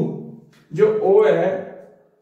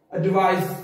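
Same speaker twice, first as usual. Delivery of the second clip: Advice as 'ad-VICE', stress on VICE; stress on second syllable. Shown as a demonstration. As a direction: hit the end of advice, closing on s. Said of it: "इस पे stress डालनी है". (Advice के 'vice' पर ज़ोर)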